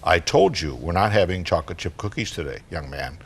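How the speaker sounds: low, firm mom voice; stern but restrained, dropping away at the end; a little attitude. Acted. Mom repeats herself to her four-year-old, a little attitude. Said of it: "with a little attitude, Mom says".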